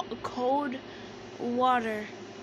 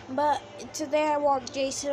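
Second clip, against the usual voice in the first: ordinary talk, nothing marked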